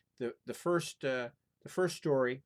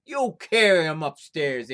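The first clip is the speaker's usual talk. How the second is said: loud talk-back; defiant retort; 5 semitones higher, 13 dB louder, slower than usual. Acted. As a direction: refuse the giant's order. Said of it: "answered Little John".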